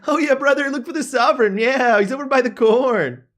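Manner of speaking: high, loud laid-back surfer dude; surfer bro voice. Acted. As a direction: chill bro calling everyone dude. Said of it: "giving them this voice".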